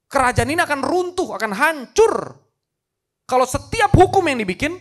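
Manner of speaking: high and forceful, acted dismay; impassioned royal anguish. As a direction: protest loudly as a king torn over his law. Anguished king argues with himself.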